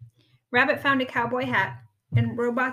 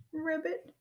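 small, high sad frog voice; a touch tearful, pitched up; sniffled; 6 semitones higher, 4 dB quieter than usual. A narrator's reading. Ribbit upset over broken Robot, mildly sniffling. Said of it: "sniffled Ribbit".

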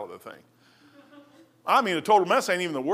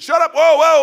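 loud interruption; 9 semitones higher, 11 dB louder than usual. Retold or imitated. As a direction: cut him off, high and loud. Professor cuts him off.

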